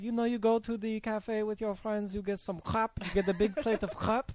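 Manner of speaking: playful imitation of the French teacher; teacher's accent. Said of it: "And she's like".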